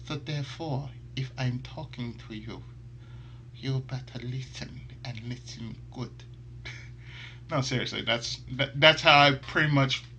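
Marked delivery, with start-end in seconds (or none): 0.1-6.2 a hint of a powerful sultry woman; soft sultry woman. Shown as a demonstration. As D Vasquez warning the listener, soft and sultry. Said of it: "So she will sound like this".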